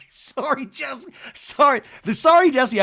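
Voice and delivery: high-pitched mock sorry; derisive, laughing imitation; mocking apology. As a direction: parrot the apology in a high, incredulous squeal. Laughing mockery of retold apology.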